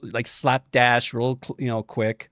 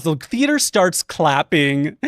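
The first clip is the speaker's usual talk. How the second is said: ordinary delivery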